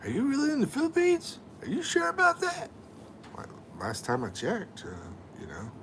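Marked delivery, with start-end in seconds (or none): 0.0-2.7 high mock voice of doubting viewers; mocking doubters. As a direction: squeak it like a skeptical fan. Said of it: "A lot of people are like".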